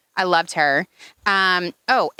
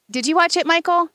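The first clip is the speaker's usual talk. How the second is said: "Did you watch it" called louder and higher across the room; calling out; 9 semitones higher, 4 dB louder than usual. Real, not acted. Host calling across to her husband Michael, loud call. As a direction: raise the voice to reach someone far off.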